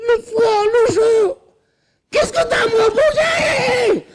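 Jacob's tearful furious tirade; Jacob explodes. Acted. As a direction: pour out twenty years of rage through tears. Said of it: "Et là, il explose".

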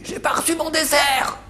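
put-on high, whiny old lady voice; old lady's complaint. Speaker imitates the old lady in the care home, high and whiny. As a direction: pitch it up like a cranky old woman. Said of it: "Elle dit".

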